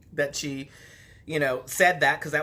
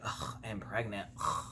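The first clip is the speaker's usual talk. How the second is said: low, much softer exasperated groan; mock groan; 7 semitones lower, 10 dB quieter than usual. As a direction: sigh it out, fed up. Voicing her own groaning reaction.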